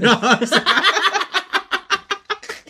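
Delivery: long high laughter. Real, not acted. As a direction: howl with laughter.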